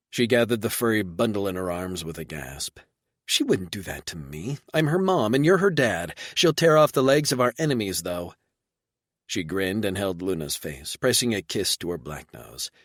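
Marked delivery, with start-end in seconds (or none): 3.3-8.3 higher and louder, proud; woman claiming the pup as hers; light proud female voice; lightly voiced woman. Reba proud.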